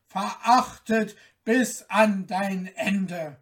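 damn her with every word; a sorcerer's loud vengeful curse; wicked curse. Acted.

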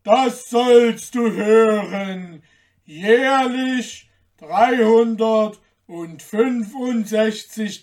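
loud, slow, booming giant voice; big giant laying down terms. A narrator's reading. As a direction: proclaim it hugely, word by word. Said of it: "sagte der Riese".

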